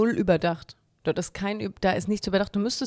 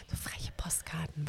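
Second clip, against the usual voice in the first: whispering; 4 semitones lower, 16 dB quieter, slower than usual. Real, not acted. Confiding about cheeky postcards.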